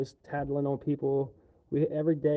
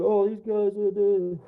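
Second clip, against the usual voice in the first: higher, louder mocking whine; 6 semitones higher, 6 dB louder, much slower than usual. Worker imitating offended coworkers, high loud mocking whine. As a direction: sneer it like a put-upon complainer. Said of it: "And these girls".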